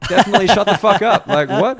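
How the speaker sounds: loud, high, fast comic retort; mock outrage. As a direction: fire back in laughing mock indignation. Acted retort to girlfriend's remark.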